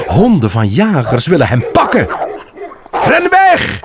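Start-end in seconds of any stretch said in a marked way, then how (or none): 2.9-3.8 high, urgent call to run; urgent shout. Acted. Great prince shouting a warning to his son. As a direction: shout it out to save him. Father warns Bambi of dogs.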